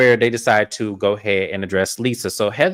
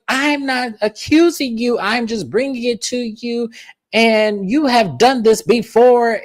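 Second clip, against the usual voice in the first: high, slow, drawn-out explaining; insistent; 10 semitones higher, slower than usual. Retold or imitated. Heather confronts Lisa over the leak, insistent. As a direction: spell it out in an imitated high voice. Heather's reply in acted quarrel.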